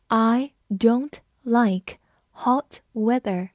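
very slow, one word at a time; slow reading. Shown as a demonstration. Teacher models example sentence for learners, slow reading. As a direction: read slowly with clear gaps between words. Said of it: "我们慢读一遍".

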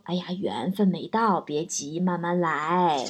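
calm them down gently; soothing parents. Retold or imitated. Parents comforting their single child, soft and soothing. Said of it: "一般情况下他们都会说".

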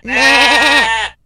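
loud drawn-out baa; goat bleat. A narrator's reading. (响亮拉长的羊叫声)

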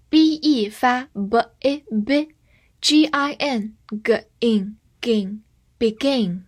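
very slow syllable building; drawn-out gin, gin, begin. Shown as a demonstration. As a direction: build the word piece by piece, slowly. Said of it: "先看前半部分".

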